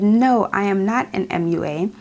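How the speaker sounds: usual speaking voice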